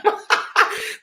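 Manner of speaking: a sudden high laugh of disbelief; high breathy laugh. Real, not acted. Laughing while retelling the absurd reality-show line.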